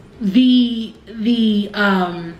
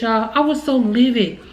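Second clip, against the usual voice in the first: ordinary delivery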